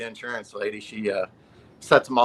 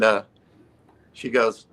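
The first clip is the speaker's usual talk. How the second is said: ordinary talk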